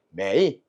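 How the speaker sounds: 매이 dipping low, then lifting; full third tone. Shown as a demonstration. Teacher shows students the unchanged tone, 매이 falling then rising. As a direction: let 매이 sink before it rises. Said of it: "이렇게 해야 돼".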